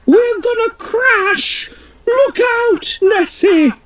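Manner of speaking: high, frantic warning cries; panicked Queen. Acted. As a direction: shout the warning while falling. Queen crashing.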